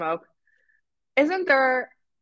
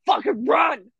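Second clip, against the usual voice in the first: raised, louder shout to flee; mock urgent yell; 5 semitones higher, 6 dB louder, slower than usual. Crowd warning everyone to flee, imitated urgent yell. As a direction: yell it as a retold alarm.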